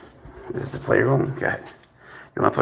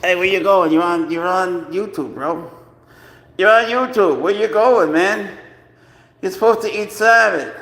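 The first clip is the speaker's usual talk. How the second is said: high, louder calls after the cat; calling after the cat; 6 semitones higher, 6 dB louder than usual. Real, not acted. Owner calling after his cat leaving the room, high and loud. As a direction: call out as the cat walks off.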